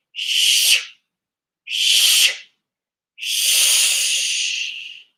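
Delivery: three sharp hissing shh breaths out; forceful shh. Shown as a demonstration. Teacher showing the class the warrior breath, loud shh. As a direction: push the air out hard on each shh. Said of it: "Watch me".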